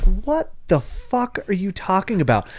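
a mock baffled outburst at nonsense; mild mock bewilderment; baffled disbelief. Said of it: "And you're like".

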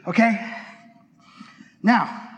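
ordinary delivery

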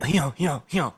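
getting into character. Pretend. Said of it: "Let me just get into character".

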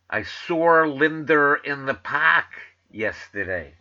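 slow New York accent with misplaced Rs. Shown as a demonstration. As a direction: demonstrate a New York accent, "saw" gaining an R, "park" losing it. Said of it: "you put the R where it belongs".

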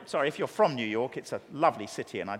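ordinary talk, nothing marked

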